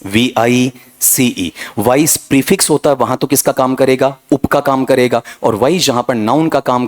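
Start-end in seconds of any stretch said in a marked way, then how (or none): 0.0-1.5 "वी आई सी ई" spelled letter by letter, slowly; spelling out letters. Shown as a demonstration. Teacher spelling vice for the class, slowly.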